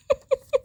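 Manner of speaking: a sudden high giggle; high laugh burst. Real, not acted. Reader laughs at the card's attitude, high giggle.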